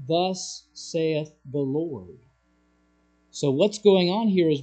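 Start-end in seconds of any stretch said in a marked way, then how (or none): 0.1-2.2 very slow, measured pronouncement; solemn scripture. Retold or imitated. Preacher quotes how the Bible speaks, slow and solemn. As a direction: let each word of God stand, unhurried. Bible's formula set against king's.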